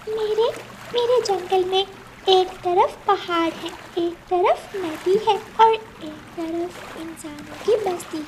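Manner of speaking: put-on a small child asking how to get home; little bird child. Gudiya asking the cloud for help, high worried child voice.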